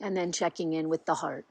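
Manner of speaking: unhurried guiding voice with long pauses; guided meditation. Real, not acted. Teacher settles listeners into body, mind and heart, unhurried guided meditation. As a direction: lead them gently inward, leaving long silences between phrases.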